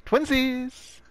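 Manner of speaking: high gleeful cry; excited squeal. Real, not acted. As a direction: squeal with delight. Excited matching moment.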